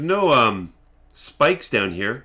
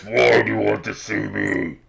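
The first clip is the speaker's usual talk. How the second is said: big put-on booming question. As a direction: boom it out to intimidate visitors. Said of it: "here, the booming voice".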